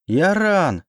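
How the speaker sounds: high-pitched desperate cry of a name; shrieked call. A narrator's reading. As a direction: shriek it out as you rush forward. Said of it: "заверещала я и кинулась вперёд".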